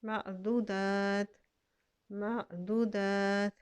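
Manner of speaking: मअदूदात slowly, ending on a still ता; pausal form. Shown as a demonstration. Teacher demonstrates the stop, slowly. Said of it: "ता को हम साकिन कर देंगे".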